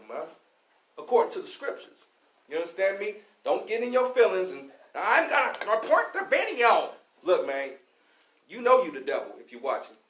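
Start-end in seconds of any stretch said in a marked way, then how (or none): 4.9-6.9 higher, whiny mock of an upset viewer; mock offended viewer. Preacher mocking an offended viewer, mock whine. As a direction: lightly mimic a huffy offended viewer.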